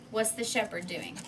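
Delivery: ordinary delivery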